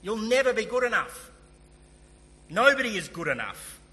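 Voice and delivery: loud, high-pitched preacher's declaration; Martin Lloyd-Jones. Retold or imitated. Preacher drives home a predecessor's verdict to the congregation, loud and authoritative. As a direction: hammer it home with full pulpit authority. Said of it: "he's a man of great authority".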